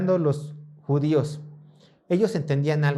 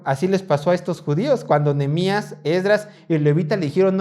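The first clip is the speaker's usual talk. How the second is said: ordinary talk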